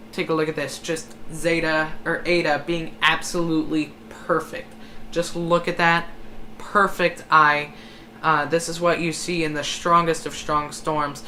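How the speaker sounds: usual speaking voice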